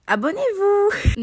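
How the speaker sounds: crié, très aigu et lent; appel exagéré. Pretend. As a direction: supplier en criant pour plaisanter. Appel exagéré, puis « premier degré ». (cri théâtral pour des abonnés)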